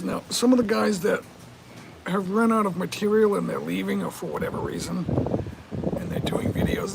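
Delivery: ordinary talk, nothing marked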